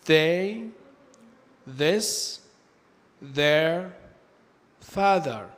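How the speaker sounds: "they", "this", "their", "father" with th like Arabic ذال; voiced th. Shown as a demonstration. Teacher giving examples of dhal sound, "they", "this", "their", "father" with voiced th. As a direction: model each word separately, "they", "this", "their", "father", with a voiced th. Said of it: "زي حرف الذال في اللغة العربية".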